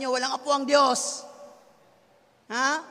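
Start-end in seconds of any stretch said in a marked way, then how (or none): none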